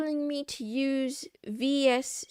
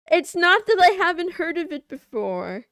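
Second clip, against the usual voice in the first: mock indignant outburst; 4 semitones higher, 11 dB louder than usual. Mock offended rant.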